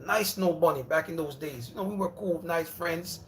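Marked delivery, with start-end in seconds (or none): none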